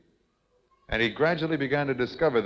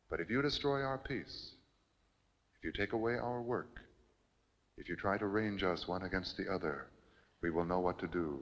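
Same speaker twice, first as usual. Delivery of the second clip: quiet menace after the shouting; hushed menacing threat; 2 semitones lower, 11 dB quieter than usual. Acted. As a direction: lean in and threaten under your breath.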